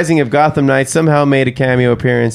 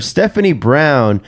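usual speaking voice

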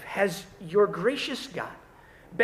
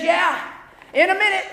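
higher, louder, distracted teen brush-off; lazy kid; 8 semitones higher, 6 dB louder than usual. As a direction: fob mom off without looking up. Lazy kid answering mom.

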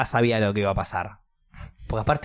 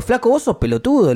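raised, high confronting voice; acted outraged insult; angry telling-off; 9 semitones higher, 5 dB louder than usual. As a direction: lay into him, loud and indignant.

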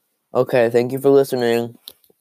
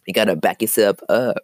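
ordinary talk

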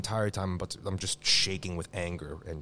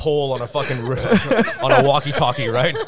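ordinary delivery

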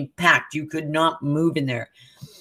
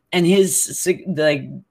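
normal talking voice

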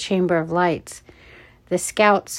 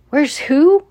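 higher, louder incredulous question; taken aback; 8 semitones higher, 6 dB louder than usual. A narrator's reading. Guard answers shouting Noah, startled high question. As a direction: throw it back in surprise.

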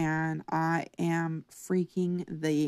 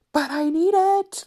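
much higher, loud furious cry; angry demand; 12 semitones higher, 10 dB louder than usual. Retold or imitated. In labour, refused the epidural, yelling at the doctor in anger. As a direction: yell it at the doctor in pain. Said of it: "so angry so fast".

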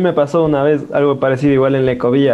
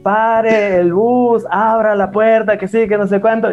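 retold shout; 5 semitones higher, slower than usual. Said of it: "La señora empieza a gritar".

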